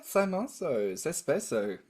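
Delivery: Italian words in a light Australian accent. Real, not acted. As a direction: say the Italian with a mild Australian twang. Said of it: "Australian accent got thicker".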